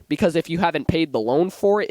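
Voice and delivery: ordinary delivery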